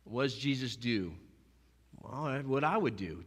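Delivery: ordinary talk, nothing marked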